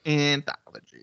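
"Anthology" slowly, syllable by syllable; teasing over-enunciation. Real, not acted. Cohost correcting his partner's slip, "Anthology" in teasing slow syllables. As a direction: spell out "Anthology" for a slow pupil.

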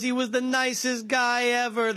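high, put-on New York neighbor voice; Italian New Yorker impression. Quoting a viral news clip, high put-on New York accent. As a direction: gush about him like a loyal Gotti neighbor. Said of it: "He goes".

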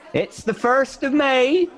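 a wild reveller heralding the day; a high, hammy voice for the costumed general; eccentric general. Acted. General's voice in poem.

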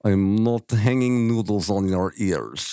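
slow, heavy Russian accent with a laugh in it. Shown as a demonstration. Asked by friends to say it in a Russian accent, slow Russian accent. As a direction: roll out the Russian proverb in a thick Russian accent, amused. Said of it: "but in a Russian accent".

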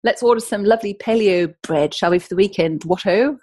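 mock posh London accent. Host mocks Robin's London friends in a posh London accent. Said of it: "They don't talk like that in London".

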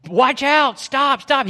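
high, loud warning shout; imitated yelling. Fisherman warning drivers of the collapsed bridge, imitated yelling. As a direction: yell a desperate warning, as in a retelling. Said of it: "he's yelling".

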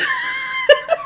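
loud squealing laugh; high shrieking laugh. Real, not acted. Friend cracking up at the story, high shrieking laugh.

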